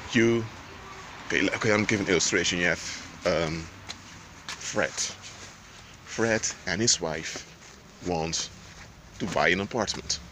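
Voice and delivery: normal talking voice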